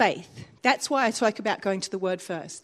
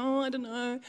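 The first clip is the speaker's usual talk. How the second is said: mock uncertain; 7 dB quieter than usual. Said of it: "don't like to just sort of go".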